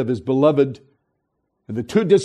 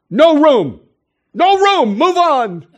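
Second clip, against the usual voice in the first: loud, high shouting innkeeper turning them away; gruff innkeeper; 9 semitones higher, 4 dB louder, slower than usual. Retold or imitated. Innkeeper shouting at Joseph at the door, loud high bark. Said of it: "and he goes".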